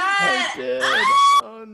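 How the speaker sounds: high, loud squeal of disbelief; excited shriek. Real, not acted. Friend reacting to a surprise love message, excited shriek. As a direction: shriek it out at the news.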